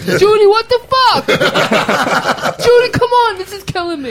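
laughing through the words; cracking up at the couple's bickering. Real, not acted.

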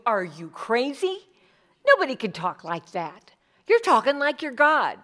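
building to a shocked accusation; acted Pharisee scolding; indignant outrage.

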